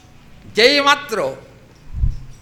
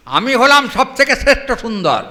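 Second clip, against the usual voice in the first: হলাম stressed, the whole line ringing out higher and louder; proud boast; 8 semitones higher, 10 dB louder than usual. Acted. Krishna boasts to the sakhis, a loud proud proclamation. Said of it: "Krishna বলছেন".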